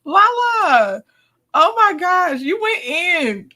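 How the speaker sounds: high, teary laughter through words; cry-laughing at the clip; can barely speak from laughing; laughing hard. Real, not acted. Recap host laughing at clip.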